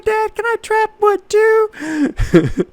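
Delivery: put-on very high, slow pleading little boy; tiny son asking his dad to chop wood; little kid voice. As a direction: ask it sweetly like a small child. Kid asks dad, imitated.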